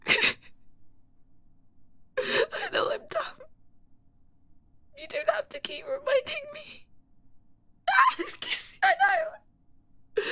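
high, broken, sobbing voice; tearful self-pity. Acted. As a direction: cry through the words.

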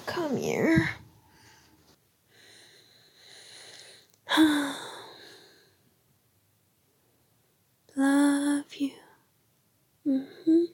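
drowsy goodnight whisper; sleepy whisper. Acted.

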